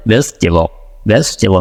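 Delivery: ভেজিটেবল clipped to a quick American "vej-tuh-bl"; American ভেজিটেবল with a short pause inside; American accent. Shown as a demonstration. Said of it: "ও কি বলে?".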